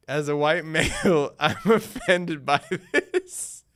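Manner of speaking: loud, slow, breaking into laughter; laughing. Real, not acted. Streamer reading out a viewer's offended comment, laughing loudly. Reads viewer's complaint, cracking up.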